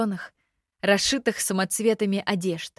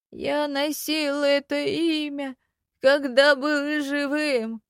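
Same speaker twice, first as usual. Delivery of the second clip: slow, high, hollow dead man voice; teeth-clacking skeleton; 6 semitones higher, slower than usual. A narrator's reading. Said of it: "клацнул зубами скелет".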